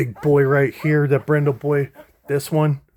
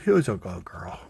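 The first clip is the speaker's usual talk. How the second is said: whispered praise; 6 semitones lower than usual. Real, not acted.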